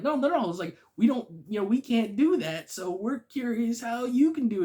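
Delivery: high, loud mock backpedaling; imitated eager protest. Imitating Spider-Men asking about web shooting, eager and high. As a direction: pitch it way up, acting out eager curiosity.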